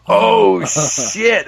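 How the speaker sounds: high, loud mock crowd reaction; crowd's oh shit. Comedian voicing the crowd's shocked oh shit. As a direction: blurt it like a stunned crowd.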